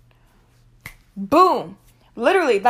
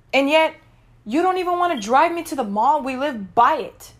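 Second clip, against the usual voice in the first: higher-pitched, incredulous challenge; indignant protest; 5 semitones higher than usual. Retold or imitated. Daughter arguing with mom about walking, indignant. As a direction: argue back in rising disbelief. Acted quarrel with mom over rides.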